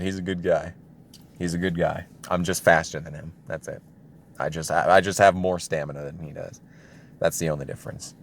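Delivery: ordinary talk, nothing marked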